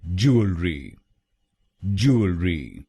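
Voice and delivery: "ज्वेलरी" said as JOO-uhl-ree, clearly; correct pronunciation. Shown as a demonstration. Teacher modelling "ज्वेलरी" for learners, clear and careful. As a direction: model "ज्वेलरी" as three crisp syllables. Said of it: "इसकी सही pronunciation है".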